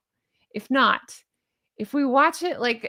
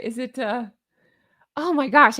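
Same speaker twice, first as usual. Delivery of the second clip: normal talking voice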